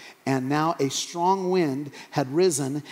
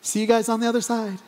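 higher, breezy farewell; cheery send-off; 6 semitones higher, faster than usual. Acted. Jesus pushing the boat off, cheery send-off. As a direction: call a quick, light goodbye.

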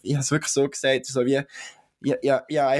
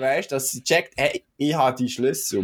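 ordinary talk

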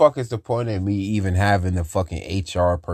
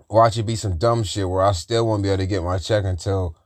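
ordinary talk, nothing marked

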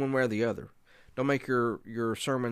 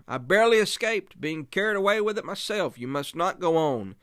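high, loud, urgent pleading; excited, earnest warning; 8 semitones higher, 6 dB louder than usual. Acted. Survivor begs the traveler to turn back, excited and earnest. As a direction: warn him off with raised, insistent voice. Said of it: "In the excitement of his feelings".

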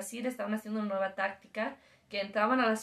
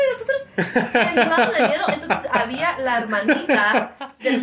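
laughing through it; 9 dB louder than usual. Real, not acted. Laughing at the scary memory.

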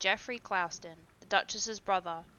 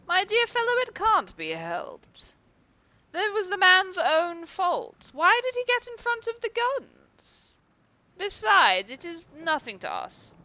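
airy dismissive; 7 semitones higher, 8 dB louder than usual. A narrator's reading. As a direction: wave it off as trifling. Lord Henry dismisses the accident.

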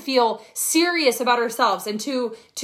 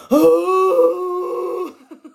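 sharp gasp; 6 semitones higher, 6 dB louder than usual. Real, not acted. Reacting to a comment.

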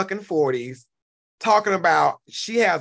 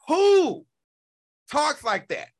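exasperated outburst; 8 semitones higher, slower than usual. Real, not acted. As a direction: cry out in disbelief. Exasperated rant at the rival.

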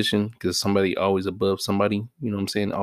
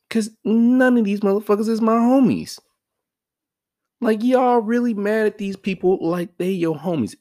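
pitched way up, loud disbelief; high incredulous rant; 12 semitones higher, 4 dB louder than usual. Real, not acted. Vlogger scolding viewers angry at politicians, high and incredulous.

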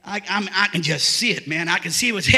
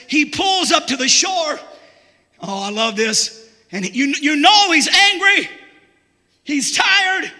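full-force preaching; 4 semitones higher than usual. Acted. Preacher shouting the story of Peter to his church. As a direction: preach it out to the rafters.

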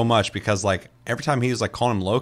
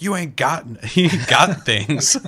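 laughing; 8 semitones higher, 4 dB louder, slower than usual. Retold or imitated. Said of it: "And he's like".

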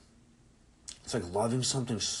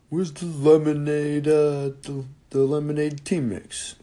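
higher put-on voice of a big athlete; imitating football player; 9 semitones higher, 6 dB louder, slower than usual. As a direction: mimic the huge guy's surprisingly high voice. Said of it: "his voice is deep".

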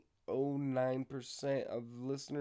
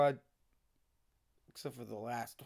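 ordinary talk

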